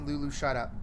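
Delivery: usual speaking voice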